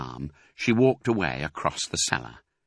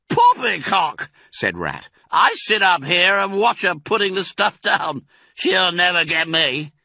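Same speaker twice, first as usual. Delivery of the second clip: Rat voice; 10 semitones higher, 5 dB louder than usual. A narrator's reading. Rat scoffing at warning.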